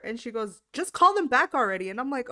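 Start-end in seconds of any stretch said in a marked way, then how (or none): 0.7-1.9 loud, higher-pitched insistence; mock impatience. Mom tired of being asked, impatient insistence. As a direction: push it with exasperated force.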